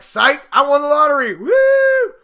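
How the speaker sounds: spring the surprise and cheer; gleeful reveal. Retold or imitated. Revealing lottery win to wife.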